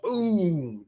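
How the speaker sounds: high, drawn-out falling "boom"; imitated bomb boom. Explaining bombs blow up on impact, imitated blast.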